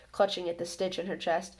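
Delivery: usual speaking voice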